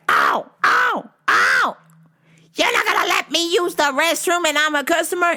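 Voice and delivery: high-pitched yelled complaint, imitating a customer; mock yelling. Shoeless customer demanding the restroom, mock yelling. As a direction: yelp in pain, then protest in a high voice. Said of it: "She was yelling".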